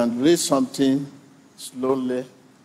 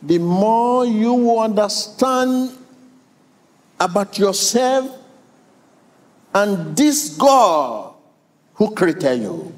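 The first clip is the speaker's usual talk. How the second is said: preaching; 6 semitones higher, slower than usual. Acted. Preacher building to climax.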